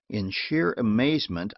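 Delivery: plain reading voice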